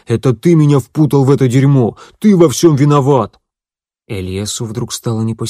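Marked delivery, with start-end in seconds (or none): 0.1-3.4 louder, jabbing accusation; accusing shout. Acted. Bruno blaming Elias for the mess, loud accusing. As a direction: blame him hard. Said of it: "обвиняюще ткнул в него пальцем".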